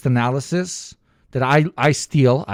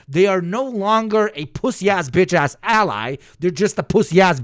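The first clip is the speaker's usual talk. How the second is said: ordinary delivery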